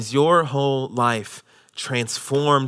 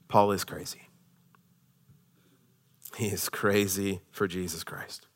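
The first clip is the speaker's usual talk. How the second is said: much lower and softer; quiet; 9 semitones lower, 13 dB quieter than usual. Real, not acted. Preacher quietly telling the congregation about Paul. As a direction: drop right down, almost confiding. Preacher drops to intimate aside.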